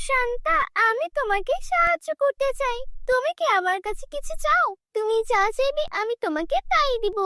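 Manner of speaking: lightly voiced caring fairy; kind fairy. A narrator's reading. Fairy offers help.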